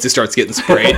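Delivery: ordinary talk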